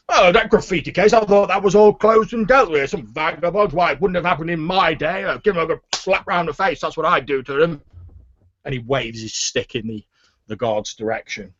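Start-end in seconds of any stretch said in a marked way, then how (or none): 0.1-1.1 higher, louder scoffing echo; blustering official. Acted. Fat official answers guard about the graffiti case, indignant. 1.1-7.8 put-on higher-pitched indignant old grumbler; blustering official. Fat official tells guard how vagabonds should be treated, indignant.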